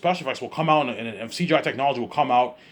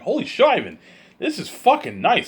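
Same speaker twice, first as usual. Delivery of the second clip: mock amazed Peter; 7 semitones higher than usual.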